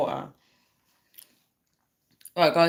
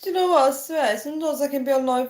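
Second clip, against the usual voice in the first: excited squeal; 8 semitones higher, faster than usual. Real, not acted. Laughing fit continues.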